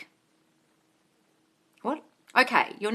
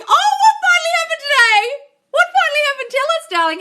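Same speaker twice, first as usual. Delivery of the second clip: much higher, louder Oh, what finally happened today?; squealing excitement; 13 semitones higher, 6 dB louder, faster than usual. Real, not acted. Thrilled for a commenter's good news, squealed. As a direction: gush it like happy news.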